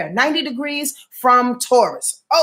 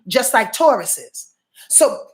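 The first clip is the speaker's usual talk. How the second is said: ordinary delivery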